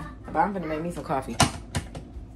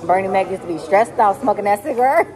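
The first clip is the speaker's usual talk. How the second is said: normal talking voice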